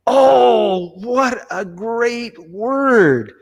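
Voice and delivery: high, drawn-out marvelling; awed realization. Retold or imitated. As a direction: savour it slowly, like a retold revelation. Retold thought, struck by a word.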